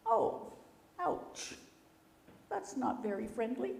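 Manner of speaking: high wounded ouch; hurt rabbit. Acted. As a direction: sound stung by the rudeness.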